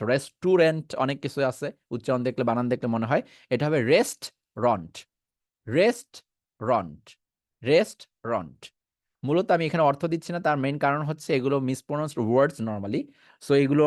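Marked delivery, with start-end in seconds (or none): 3.5-8.7 রেস্টরন্ট slowly as rest-ront, two syllables; slow restaurant drill. Shown as a demonstration. Teacher slowly drilling রেস্টরন্ট for the class. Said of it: "বলতাম রেস্টাউরেন্ট".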